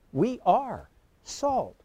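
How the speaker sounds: slow, deliberate "are", then "salt" on its own; emphatic stress. Real, not acted. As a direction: hold "are" and pause before "salt". Preacher repeats the corrected wording.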